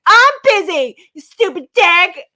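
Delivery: high, loud movie quote; quoting Anna Faris. Retold or imitated. Co-host quotes an angry wife from a movie to her partner, loud and high. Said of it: "She's like".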